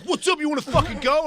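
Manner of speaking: high, loud aggressive taunt; heated retold provocation; imitated fight challenge. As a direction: imitate someone squaring up for a fight. Stranger challenges him to fight.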